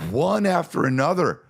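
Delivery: put-on high-pitched exasperation in own accent; exasperated. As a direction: complain in a high, fed-up voice. Usual accent, before British version.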